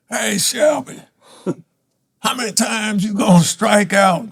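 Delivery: high taunting heckler voice, well above his own; a fan taunting the batter, high and mocking. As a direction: jeer it down from the stands. Heckler taunting him from stands.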